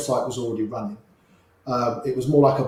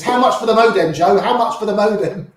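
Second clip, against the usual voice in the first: eager urgent plea; 6 semitones higher, 4 dB louder than usual. Retold or imitated. Ram running after him, eager.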